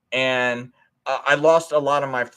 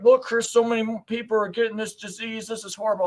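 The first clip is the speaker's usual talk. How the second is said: higher, alarmed friend voice, lightly acted; worried friend; 8 semitones higher than usual. Democrat friend warning Chris about the disease, mock alarmed. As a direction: play the panicked friend urging him. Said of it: "he was like".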